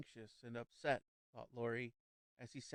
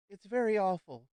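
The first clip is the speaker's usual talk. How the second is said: anxious awful; 7 semitones higher, 9 dB louder than usual. A narrator's reading. Lady Laura's anxious warning.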